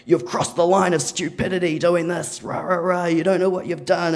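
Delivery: high, ranting mock yell. Neighbour ranting over the fence hole, mock yelling. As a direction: imitate an irate neighbour yelling. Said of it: "He starts yelling at us".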